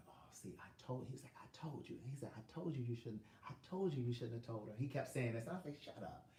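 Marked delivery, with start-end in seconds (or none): 0.1-4.7 hushed whisper. Retold or imitated. Ex whispering during phone call. 5.8-6.3 hushed. Retold or imitated. Hushing ex during call.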